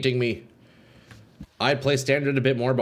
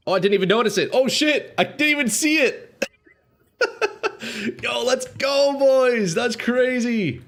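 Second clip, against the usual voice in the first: frat bro; 9 semitones higher than usual. Real, not acted. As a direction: lose it over a max win. Huge lucky corrupt, hype reaction.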